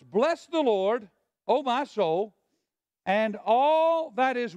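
drawn-out scripture reading; slow recitation. Shown as a demonstration. Preacher reading scripture slowly to the church. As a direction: read the verse slowly for the church to finish.

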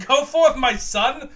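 lightly voiced grand farewell; mock grand blessing. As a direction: send him off like a lightly hammed prophet. Sends Timmy out on mission.

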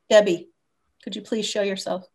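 ordinary delivery

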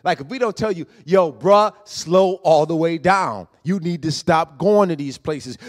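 loud, pushing sermon voice; preaching at full force. Acted. Preacher calls out the brothers and sisters, loud and pressing. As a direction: call them out from the pulpit.

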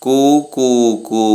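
cuckoo call. Shown as a demonstration. Teacher imitating the cuckoo's koo call. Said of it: "ആരാണ് കൂവുന്നത്".

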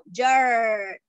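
"Yard" opening like Spanish ll, "jarr", drawn out and falling; double-L sound. Shown as a demonstration. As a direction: stretch "Yard" into a long "jaarr" with a j-like start. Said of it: "Suena como doble L".

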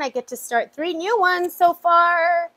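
ordinary delivery

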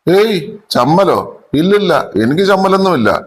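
stammering denial. A narrator's reading. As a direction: stumble over the words, embarrassed. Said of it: "വിക്കി വിക്കി അവൻ പറഞ്ഞു". (ചമ്മലോടെ വിക്കി വിക്കി)

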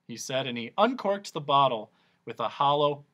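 plain reading voice

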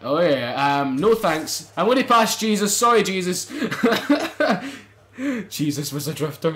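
ordinary talk

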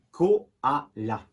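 slow "koala" in three separate pieces; syllable by syllable. Shown as a demonstration. Teacher naming the picture for pupils, syllable by syllable. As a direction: spell out "koala" by sound, ko, a, la, slowly. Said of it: "On va le taper".

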